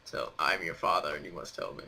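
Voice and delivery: a hint of a menacing movie father; Darth Vader voice. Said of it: "Star Wars?".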